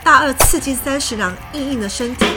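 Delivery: ordinary delivery, nothing marked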